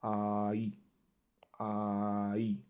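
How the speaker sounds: आई said as a clear "eye" diphthong; German ei sound. Shown as a demonstration. Teacher showing how German ei is said: clear आई. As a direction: model आई twice, crisp. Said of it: "उसका pronunciation होगा as".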